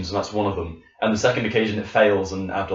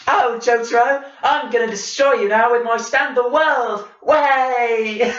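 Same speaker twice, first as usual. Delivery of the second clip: high, loud overdone British voice; bad movie dubbing; 13 semitones higher, 4 dB louder, slower than usual. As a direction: ham up a cheesy dubbed British villain. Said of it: "he's Tracer. He's just like".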